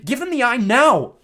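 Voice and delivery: high, loud, drawn-out order; urgent command. A narrator's reading. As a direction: order your friend, with urgency. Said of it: "Annabeth said more urgently".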